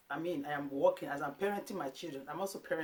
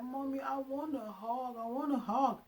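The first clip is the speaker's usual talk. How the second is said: put-on high, slow pleading little boy; imitating her son; 9 semitones higher than usual. Son begging mom for a hug mid-scolding, high and slow. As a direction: beg for a hug like a small child. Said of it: "And then my son like".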